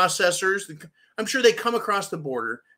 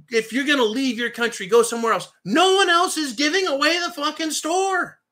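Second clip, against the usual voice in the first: high mock shout, building to a holler; hammed-up scolding that rises to a shout; retold yelling; 9 semitones higher, 3 dB louder than usual. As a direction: imitate a governor yelling, climbing in pitch. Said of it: "yelled at them".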